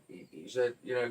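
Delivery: ordinary talk, nothing marked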